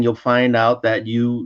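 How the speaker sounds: ordinary talk, nothing marked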